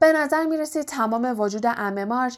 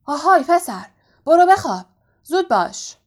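high, loud, furious order; angry shout; 6 semitones higher, 8 dB louder than usual. A narrator's reading. Uncle ordering Harry to bed, angry shout. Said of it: "با حالت غضبناکی فریاد زد".

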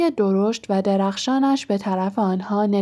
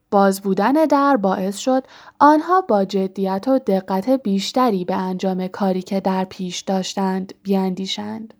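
ordinary delivery, nothing marked

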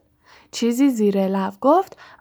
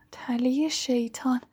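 whisper; 3 semitones higher, 8 dB quieter than usual. Acted. Repeating it to herself, thinking.

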